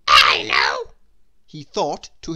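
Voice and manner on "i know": tiny character voice, louder and airy; Mister Small's voice. Acted. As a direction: voice the little man with a strained, airy tone. Mister Small thinks aloud.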